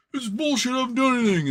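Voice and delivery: high, indignant complaining voice; whiny punk. Retold or imitated. Punk complaining to the bartender, in a high indignant voice. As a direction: let the punk sulk out loud. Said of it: "He's like".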